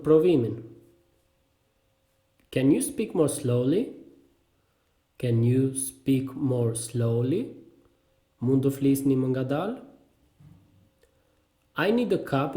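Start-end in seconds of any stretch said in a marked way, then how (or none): 5.2-7.4 very slow question, words spaced out; slow repeat. Shown as a demonstration. Teacher repeats a learner's useful question, slowly. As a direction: ask it word by word. Phrase drill, slower repeat.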